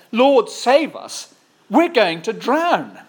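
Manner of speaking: high, loud, slow cry of panic; begging the Lord for rescue; panicked plea. Acted. Disciples crying out to Jesus in the storm, panicked and loud. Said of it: "The disciples went and woke him, saying".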